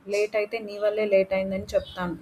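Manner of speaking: plain reading voice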